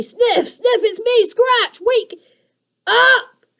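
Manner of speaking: high creature calling; urgent creaker. A narrator's reading. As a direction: shake a friend awake. Goblin waking friend.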